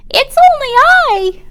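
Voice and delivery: very high squeak; tiny high squeak; troll squeak. Acted. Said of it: "the troll squeaked".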